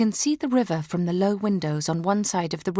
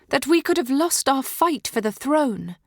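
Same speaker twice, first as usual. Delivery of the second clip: ordinary delivery, nothing marked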